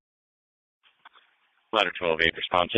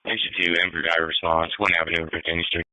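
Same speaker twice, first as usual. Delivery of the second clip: police radio; 11 semitones lower, 3 dB louder than usual. Real, not acted. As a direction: voice over a radio channel. Dispatch heard over radio.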